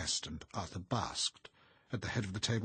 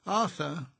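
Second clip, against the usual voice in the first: high, loud reproving call; impatient interruption; 11 semitones higher, 9 dB louder than usual. A narrator's reading. Missus Montague unable to contain herself, exasperated. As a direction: cut in on him, voice raised. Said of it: "could contain herself no longer".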